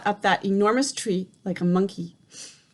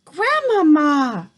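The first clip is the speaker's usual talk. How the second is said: long, loud, high, slow call; shouted again; 6 semitones higher, 8 dB louder, slower than usual. A narrator's reading. Boy shouts down to grandmother once more, loud and slow. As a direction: call her name out again. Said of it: "I shouted again".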